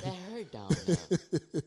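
giggling high-pitched repeat; laughing complaint. Retold or imitated. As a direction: laugh out the mock pain, drawing it out. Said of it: "You know I'm a boy".

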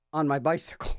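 usual speaking voice

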